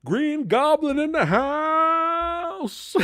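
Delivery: very high, slow, cackling villain cry; Green Goblin imitation. Retelling the bad stage show to the audience, a hammed-up high villain cry. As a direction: stretch it out like a hammy stage villain. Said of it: "He goes".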